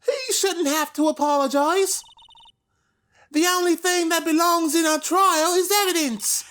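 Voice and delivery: put-on high, firm Scottish accent; proud kid with Scottish accent. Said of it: "So you're Scottish now".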